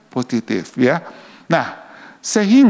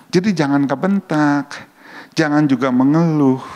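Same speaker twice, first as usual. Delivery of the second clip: usual speaking voice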